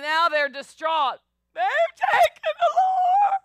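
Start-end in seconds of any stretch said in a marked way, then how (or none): 1.5-3.4 high, loud wail of despair; anguished cry. Retold or imitated. Women at the tomb wailing to the angel, high anguished cry. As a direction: cry it out high and slow, heartbroken.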